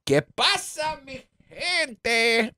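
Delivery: high-pitched Spanish shout-out greeting. Acted.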